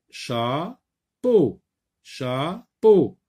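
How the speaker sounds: each "Chapeau" as cha, pause, peau; split into syllables. Shown as a demonstration. Teacher sounding out syllables for pupils, "Chapeau" pulled apart. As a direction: stretch "Chapeau" into two separate beats. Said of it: "Dans la première syllabe ou dans la deuxième syllabe".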